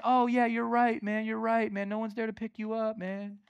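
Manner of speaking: coddle him, letting the voice sink away; mock sympathy. Preacher imagining Jesus indulging the man's complaint, mock sympathy. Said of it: "Jesus doesn't even say".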